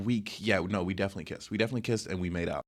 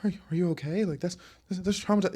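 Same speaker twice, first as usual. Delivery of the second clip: high, stammering mock alarm; mock freaking out; 9 semitones higher than usual. People reacting to the speaker's story, mock freaked out. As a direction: imitate people fussing in shock, pitched up. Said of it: "freaking out about it".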